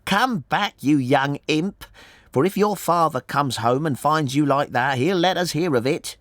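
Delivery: high-pitched motherly scolding; shouted. A narrator's reading. Mother warns her young imp about his father, shouted. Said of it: "she shouted".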